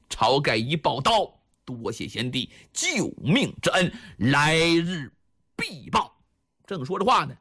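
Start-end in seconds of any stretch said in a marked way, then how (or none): 1.6-6.1 低沉有力的江湖告别; 一字一顿地许诺报恩; 郑重谢恩. A narrator's reading. 晁盖抱刀谢恩告别. (低沉郑重地道谢)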